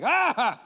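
loud, high cry of mock panic; overwhelmed yell. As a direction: yell as if swamped by bills. Acts overwhelmed by debt collectors.

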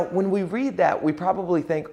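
normal talking voice

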